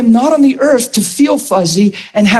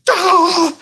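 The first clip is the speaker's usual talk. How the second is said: high cry of joy; 11 semitones higher than usual. Retold or imitated. Overwhelmed in his truck.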